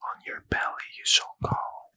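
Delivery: usual speaking voice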